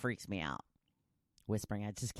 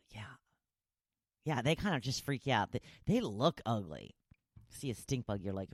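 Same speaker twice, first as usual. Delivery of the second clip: ordinary delivery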